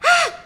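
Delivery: sudden high loud scream cut short; a scream she tries to hold in; bursting scream choked back; suppressed scream. Retold or imitated. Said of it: "it's one of those".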